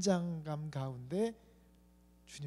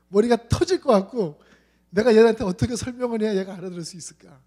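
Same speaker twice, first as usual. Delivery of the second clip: exasperated; 3 semitones higher, 10 dB louder than usual. Retold or imitated. Pastor recalls frustration.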